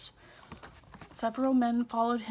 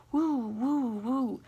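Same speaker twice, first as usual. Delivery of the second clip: hooting woo, pitched up like an owl; 2 semitones higher, much slower than usual. A narrator's reading. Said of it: "like a hooting of an owl".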